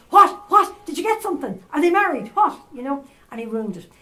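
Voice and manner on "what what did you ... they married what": high, loud, tumbling questions; overly excited. Retold or imitated. Uncle on the phone blurting out eager questions, overexcited. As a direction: fire the questions off in eager disbelief. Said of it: "he got overly excited".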